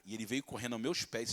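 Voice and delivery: ordinary talk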